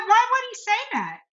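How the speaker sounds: high, quick disbelieving question; incredulous question. Retold or imitated. Oncologist hearing the surgeon ruled out surgery, incredulous. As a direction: imitate someone baffled, pitch shooting up.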